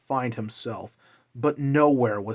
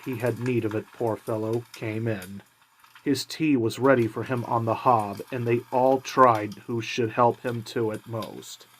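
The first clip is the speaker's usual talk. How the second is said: plain reading voice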